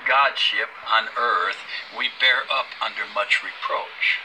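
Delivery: loud preaching read. Acted. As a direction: proclaim it from the pulpit. Reads scripture aloud, preaching.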